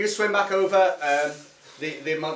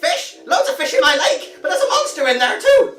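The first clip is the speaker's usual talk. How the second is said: put-on high, cracked old man squeak; 10 semitones higher, 7 dB louder than usual. GM voicing the old lake hermit, high old man voice. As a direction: play the naked old hermit, high and quavery. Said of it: "He goes".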